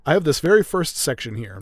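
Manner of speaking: ordinary talk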